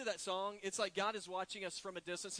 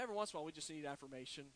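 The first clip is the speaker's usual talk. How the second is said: normal talking voice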